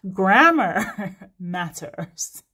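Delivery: slow, laughing through the words. Real, not acted. Reader joking about grammar, laughing. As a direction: chuckle it out slowly. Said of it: "laughing joke".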